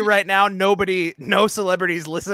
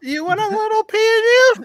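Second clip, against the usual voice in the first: much higher, louder silly pitch-man voice; mock offer; 5 dB louder than usual. Host echoing the joke to listeners, silly high offer. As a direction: squeal the offer in a put-on high voice.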